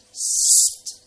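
loud, long "sst" hush; sharp hissed shush. A narrator's reading. Ladies hushing the minister at the fishing, loud shush. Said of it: "fluisterde de dames Groen".

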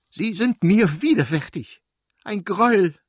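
high, loud, furious outburst at her husband; shrieking wife, heating up; screamed in anger. Acted. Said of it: "schrie sie und geriet immer mehr in Hitze".

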